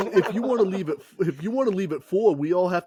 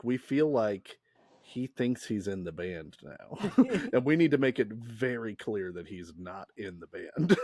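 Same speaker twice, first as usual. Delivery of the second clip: lower, softer, earnest mock sincerity; a hushed, overly serious band meeting; 7 semitones lower, 7 dB quieter than usual. The band about the therapist, low, soft and earnest. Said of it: "the earnest conversation they had where they're like".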